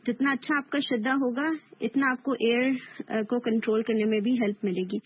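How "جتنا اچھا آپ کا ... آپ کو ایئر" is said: ordinary delivery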